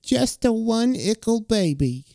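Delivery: put-on just the one ickle baby, high and slow in creepy baby talk. Deranged father cooing over the baby's crib, in creepy baby talk. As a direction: coo it like a grown man doing baby talk. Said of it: "he talks in baby speech".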